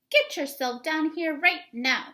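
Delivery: mother summoning her son; stern mother calling. Acted. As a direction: call him down like a cross mom. Mother calls son downstairs.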